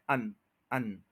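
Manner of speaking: अन said short and soft twice; short schwa syllable. Shown as a demonstration. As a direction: clip अन to a quick schwa. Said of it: "अ और एन को मिला लें".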